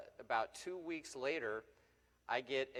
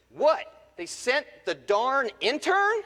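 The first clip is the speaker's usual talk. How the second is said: much higher, loud, slow outraged bark; scornful shout; 13 semitones higher, 15 dB louder, slower than usual. Retold or imitated. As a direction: blast it like a gruff racing legend. Earnhardt scoffing at young reporter.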